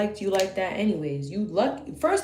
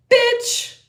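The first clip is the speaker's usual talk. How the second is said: sudden high, loud yelled bitch; high squealed insult; 7 dB louder than usual. Retold or imitated. Insulting him in a high loud squeal.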